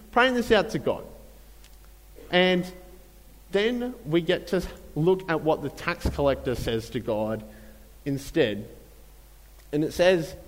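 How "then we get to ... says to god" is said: normal talking voice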